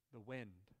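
low, soft, breathy wind; soft windy voice. Shown as a demonstration. Preacher playing the mother as the wind, soft. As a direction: say it like a gentle gust. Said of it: "you gotta do the sound effects".

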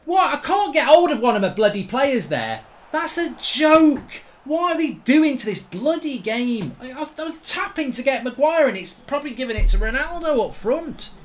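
high-pitched, indignant complaint at the game; exasperated rant. Real, not acted. Ranting at game not switching players.

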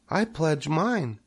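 high, slow small voice; tiny creature making a pledge; Joy the little spider. A narrator's reading. Spider pledges friendship to Wilbur, high voice. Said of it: "said Joy".

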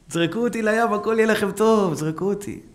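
high, loud plea; pleading Jonah. Acted. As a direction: beg the sailors to throw him over. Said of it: "הוא אומר להם".

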